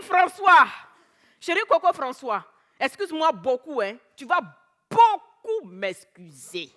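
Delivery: put-on loud African-accented protest, slowing at the end; weary wife reverting to her African accent. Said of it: "j'ai repris mon accent africain, j'étais fatigué".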